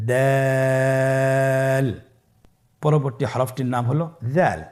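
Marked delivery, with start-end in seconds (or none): 0.1-1.9 দাল held long for three alif lengths; দাল sung out long and steady; drawn-out letter. Shown as a demonstration. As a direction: stretch দাল slowly and evenly, like a Quran teacher. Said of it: "আবার বলুন".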